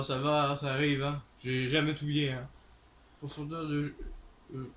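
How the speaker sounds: ordinary talk, nothing marked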